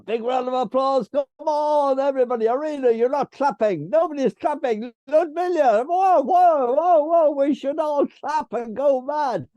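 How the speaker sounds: loud, high call to get everyone clapping; rousing the crowd. Real, not acted. Host urging the audience to applaud, loud and high-pitched. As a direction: whip up the room, big and high.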